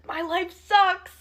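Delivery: high, loud, drawn-out mock wail; mock crying. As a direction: imitate a tearful outburst, hammed up.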